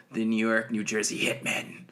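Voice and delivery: ordinary talk, nothing marked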